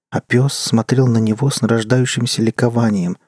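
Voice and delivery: plain reading voice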